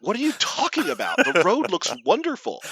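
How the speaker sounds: high-pitched, laughing disbelief; mock incredulous son. Son answering dad's road complaint, acted incredulity.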